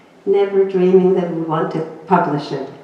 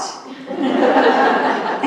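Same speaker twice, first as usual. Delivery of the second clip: a high, airy laugh; breathy laugh; 7 semitones higher than usual. Real, not acted. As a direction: giggle at the memory. Laughing after husband's "What?.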